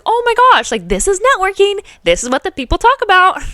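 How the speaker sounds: high, loud giddy gush; thrilled to be networking at last; excited. Retold or imitated. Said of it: "I was so happy".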